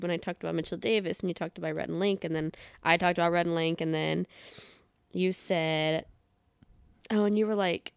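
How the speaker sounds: ordinary delivery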